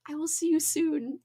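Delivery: unvoiced soft see you soon; whispered goodbye. Real, not acted. Streamer whispering goodbye to chat before sprint. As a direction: breathe the farewell.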